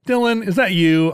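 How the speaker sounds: calling out nervously to a son; a light touch of fear; worried call into the house. Retold or imitated. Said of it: "you're gonna be like".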